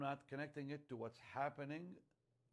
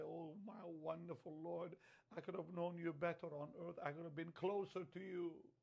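imagined regretful prayer; 4 semitones higher than usual. Pretend. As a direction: confess gently, as if standing before God.

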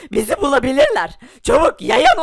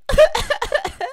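high evil laugh; 11 semitones higher than usual. Acted. Thief greets rich friend with an evil cackle. Villain laughs after entering.

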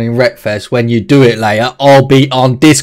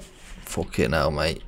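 much softer, slow muttered curse; soft, drawn-out dismay; 10 semitones lower, 16 dB quieter, slower than usual. Real, not acted. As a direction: grumble it under your breath. Muttered curse at rusty car.

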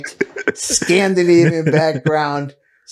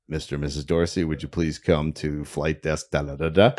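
airport announcement; 8 dB quieter, faster than usual. Retold or imitated. Mimics airport desk announcement.

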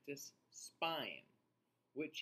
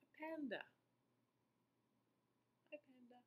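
much higher, then a tiny soft hello; delighted high; 11 semitones higher, 9 dB quieter, much faster than usual. Acted. Reader delightedly greeting the panda picture. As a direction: coo it up high like greeting a baby animal.

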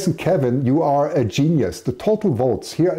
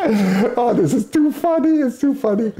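laughing; 10 semitones higher than usual. Real, not acted. Laughing at own announcing mistake.

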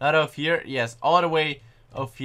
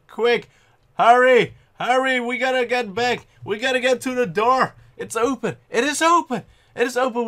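urgent hurry; 8 semitones higher, 5 dB louder than usual. Real, not acted. Racing to the door in game.

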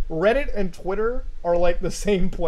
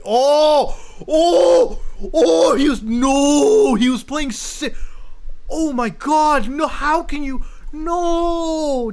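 high, drawn-out wails of disbelief; loud, stretched "oh no" anguish, easing in volume; dismayed outcry; 7 semitones higher, 4 dB louder, much slower than usual. Real, not acted. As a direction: cry out in over-the-top horror. Dismayed discovery of phone game.

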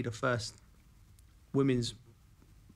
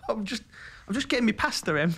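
higher, laughing, sheepish excuse; sheepish laughing reply; 8 semitones higher, 4 dB louder than usual. Retold or imitated. [name] dodging Emma's question, higher and laughing sheepishly. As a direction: laugh it off awkwardly. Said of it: "I'm like".

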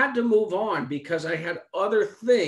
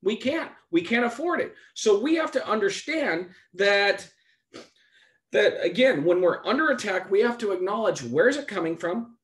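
higher, louder preaching push; 5 semitones higher, 3 dB louder than usual. Acted. Preacher urges the congregation, rising preaching. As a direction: drive the point home to the church.

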